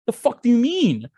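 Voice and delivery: high, loud indignant outburst; outraged disbelief. Retold or imitated. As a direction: throw it out in affronted disbelief. Acting outraged reaction to phone cutoff.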